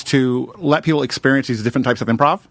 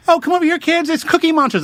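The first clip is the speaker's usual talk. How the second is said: much higher, sing-song mock parent calling kids; mocking adult. Parent calling kids to a puppet, high mock gushing. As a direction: gush over the puppet to the children. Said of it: "they'll see a balloon and like".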